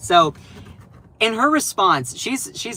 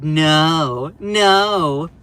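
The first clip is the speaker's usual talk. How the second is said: louder, clipped refusal; mock naysayer; 5 dB louder than usual. Streamer mimicking chat deniers, loud refusal. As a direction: sound like a stubborn denier. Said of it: "All the people that are like".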